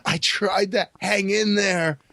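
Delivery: mock expiring mid-sentence; dying boy's last words. Dying kid giving last words, hammed-up expiring.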